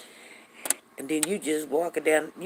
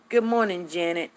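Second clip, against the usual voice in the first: ordinary talk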